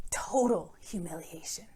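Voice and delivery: whispered in mock dread. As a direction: breathe it out like a dreaded fate. Dread of being humiliated.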